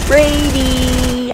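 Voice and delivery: calling a name from far off, high; retold distant yell. Mom calling her lost son from afar, high yell. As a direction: yell the name across the beach. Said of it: "I hear someone yell".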